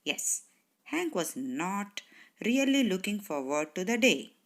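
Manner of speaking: ordinary delivery, nothing marked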